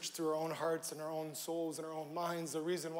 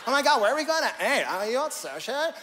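louder, fast put-on chatty voice; 6 semitones higher, 10 dB louder than usual. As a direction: rattle off the dinner fuss in a silly voice. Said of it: "I don't know why I went into that voice".

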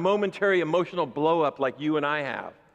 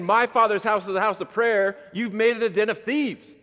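louder, driving indignation; forceful quote; 6 semitones higher, 5 dB louder than usual. Retold or imitated. Jesus chasing sellers from the temple, loud. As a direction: declare it with righteous force. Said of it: "he was saying".

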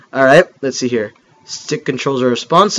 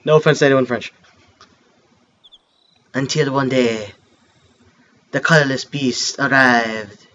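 softer, lower storytelling read; story narration; 4 semitones lower, 4 dB quieter than usual. Acted. Gamer reading the game's opening story, soft narration. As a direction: read the legend like a mock French narrator. Reads game intro narration aloud.

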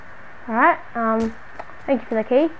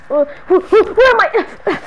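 slow, confused waking up; groggy alarm at waking in chains; startled waking; 6 semitones higher, 3 dB louder, slower than usual. Acted. Waking in chains, slow startled confusion.

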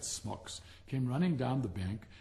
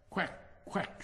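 duck quack; 6 semitones higher than usual. Acted. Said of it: "she said".

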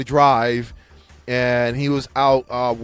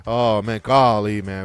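lower, fretful coach downplaying his team; mock Lou Holtz; 7 semitones lower, slower than usual. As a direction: sandbag like a worried old coach. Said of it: "You know how Lou Holtz used to go".

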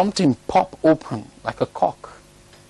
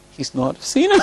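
amused disbelief; 10 semitones higher than usual. Real, not acted. Amused at the man's denial.

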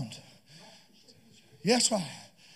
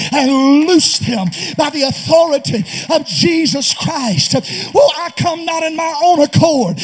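fiery sermon shout; preaching at full force; 4 semitones higher, 7 dB louder than usual. Real, not acted. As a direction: thunder it from the pulpit.

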